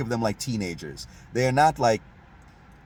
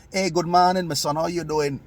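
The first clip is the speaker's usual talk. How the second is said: bright, loud, higher Saint Kitts greeting; Kittitian accent; 6 semitones higher, 5 dB louder than usual. Retold or imitated. Imitating a local greeting in a Saint Kitts accent. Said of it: "a person from Saint Kitts would say".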